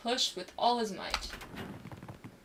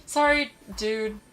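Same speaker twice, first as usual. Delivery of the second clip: slow, hammed-up insincere sorry; mocking insincere apology; 7 dB louder, slower than usual. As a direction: drag out a fake apology. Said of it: "the most insincere dude I've ever heard".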